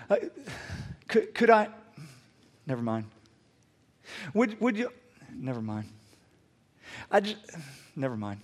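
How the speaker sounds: imitated halting request, high start then dropping; awkward false starts. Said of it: "It was awkward".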